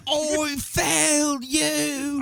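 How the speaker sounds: very slow, mournful Cockney butler; Michael Caine impression. Podcaster mimics Alfred's regret, slow Caine impression. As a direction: drag out a tearful Caine apology. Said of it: "great Michael Caine".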